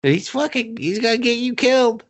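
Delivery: high squeaky mock pleading. Creep begging Faith to leave with him, squeaky. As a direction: whine it way up high like a desperate creep. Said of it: "and is like".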